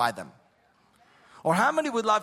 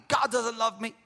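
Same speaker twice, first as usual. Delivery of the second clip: retold yelling; 5 semitones higher than usual. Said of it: "She started yelling at me".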